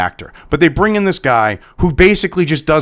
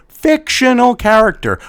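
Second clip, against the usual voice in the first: "Fictional character" pitched high and drawn out; slow, high stress on "Fictional character"; exasperated emphasis; 7 semitones higher than usual. Shown as a demonstration. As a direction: spell out "Fictional character" slowly, fed up. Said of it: "how many times does someone have to say".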